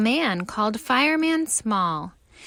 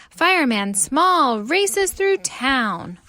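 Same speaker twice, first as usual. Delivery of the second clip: plain reading voice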